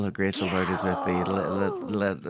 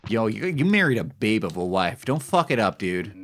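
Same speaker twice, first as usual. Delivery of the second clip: usual speaking voice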